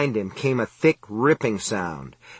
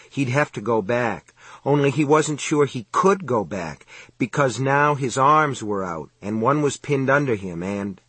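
usual speaking voice

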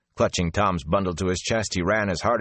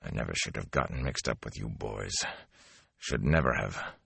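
low, softer rough growl; 10 semitones lower, 8 dB quieter than usual. A narrator's reading. Tom regretting the boys, a low growl. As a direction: growl it under his breath. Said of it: "Abruptly, Tom growled".

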